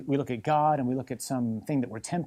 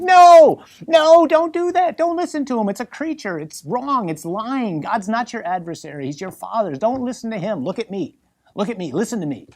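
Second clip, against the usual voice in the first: high, loud desperate cry of "No, no, don't do that", easing as it goes on; urgent pleading shout; 9 semitones higher, 12 dB louder than usual. Acted. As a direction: plead with her like her life depends on it. Preacher voices Adam pleading with Eve.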